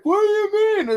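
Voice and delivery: high, loud baffled driver; confused driver. Retold or imitated. Driver of the burning car not getting it, high and loud. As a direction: squeak it out, totally baffled. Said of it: "they're like".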